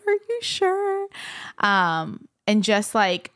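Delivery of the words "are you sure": high, drawn-out imitation of tearful doubt; mock emotional mess. A mess, doubting the pastor's kindness, mock tearful. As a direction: squeak it out slowly, hammed-up and pitiful. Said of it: "you know, a mess".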